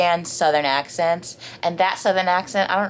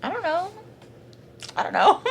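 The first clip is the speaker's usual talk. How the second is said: imitating herself; 13 semitones higher, faster than usual. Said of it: "I'm like".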